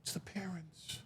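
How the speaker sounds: hushed, breathless whisper; softly whispered reveal. Retold or imitated. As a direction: whisper it like a secret. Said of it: "whispered aside".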